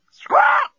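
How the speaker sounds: very high, loud bird screech; parrot squawk. Acted. Parrot refusing to answer, piercing squawk.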